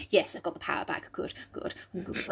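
hushed, voiceless self-congratulation; whispered aside. Acted. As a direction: whisper it to yourself, fading away. Aside after acted recovery.

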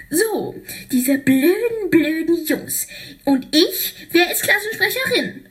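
high, quickening put-on girl voice; eager character voice. Piper hoping she won, high eager voice. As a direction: rush to the question.

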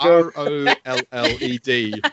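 carefully spelled, each letter apart; slow deliberate spelling. Shown as a demonstration. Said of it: "No, uh, rolled".

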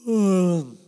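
a long, loud real yawn; loud yawn, voice sliding down at the end; big yawn. Real, not acted. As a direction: let a huge yawn take over. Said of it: "'cause that was a big one".

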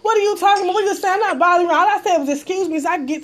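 heated retort. Retold or imitated. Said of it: "I'm like".

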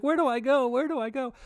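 high, frantic near-tears pleading; panicked girlfriend. Retold or imitated. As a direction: imitate her close to crying. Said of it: "She's like".

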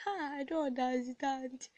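retold shock. Co-host reacting to hearing her own recorded voice, mock shocked. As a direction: mimic baffled surprise, drawn out. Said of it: "She was so shocked".

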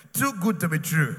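shouted, TOO punched hard; loud declaration. Shown as a demonstration. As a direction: proclaim it to the whole hall. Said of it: "many of you are even saying it".

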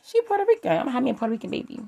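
mock a guy bragging about a Puerto Rican baby; mock shallow suitor. Mocking people marrying for wrong reasons.

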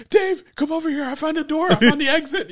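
high, excited call across the room; excited Aaron. Retold or imitated. Aaron calling Dave toward the exit, high and excited. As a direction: call him over, thrilled to find a way out. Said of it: "Aaron's like".

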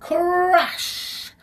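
high, loud, drawn-out exclamation; dramatic crash. A narrator's reading. The net comes down on it, dramatic crash. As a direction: burst out on one long word. Narrator voices the net landing.

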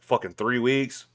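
ordinary talk

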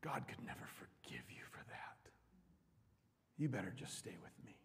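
Satan's sneering whisper. Acted. Satan whispering condemnation to the sinner. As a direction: hiss shame into their ear. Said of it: "and then he says".

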